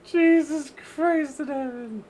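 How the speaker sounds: high, loud cry of dismay; shocked exclamation. Real, not acted. Streamer shocked by the broken game, crying out high. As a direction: squeal it in disbelief.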